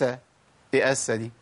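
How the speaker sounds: ordinary talk, nothing marked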